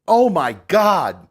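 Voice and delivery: loud slow disgusted groan. Real, not acted. Eating, disgusted.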